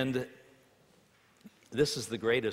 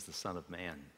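ordinary talk

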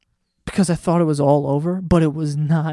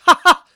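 loud, high burst of "ha ha"; short, high, re-enacted cackle; imitated laugh-out-loud; 13 semitones higher, 8 dB louder than usual. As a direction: let two big laughs escape. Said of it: "I laughed, like, out loud".

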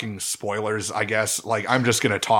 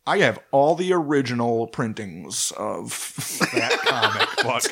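lightly voiced proud nerd; a hint of a geeky collector bragging. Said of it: "That's my nerd voice".

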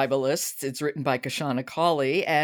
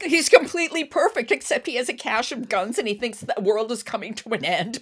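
much higher, louder mock rave about the perfect guy; mock gushing; 8 semitones higher, 3 dB louder than usual. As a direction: sarcastically gush over a dream boyfriend.

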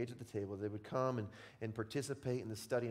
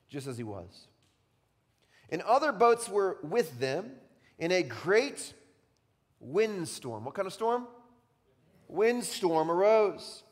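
scripture reading; 8 semitones higher, 10 dB louder than usual. Acted. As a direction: read the storm passage with rising drama. Reads Mark aloud.

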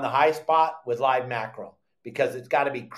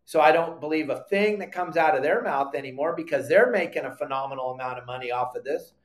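normal talking voice

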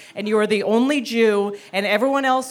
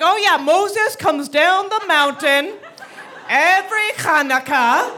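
slow, higher storybook-mom voice; raised, drawn-out mock-enchanted telling; mother making it up; 7 semitones higher, slower than usual. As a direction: imitate a mother spinning a tall tale. Mother inventing a tale.

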